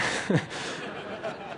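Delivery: quiet breathy laugh; soft chuckle. Real, not acted. Storyteller amused at fleeing to the airport, soft chuckle. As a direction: chuckle softly to yourself.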